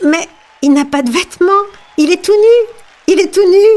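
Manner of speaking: put-on high-pitched child's voice calling out; crying-out child. A narrator's reading. Child in the crowd exposes the emperor, crying out high. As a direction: pipe up high, climbing to a cry. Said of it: "un enfant cria".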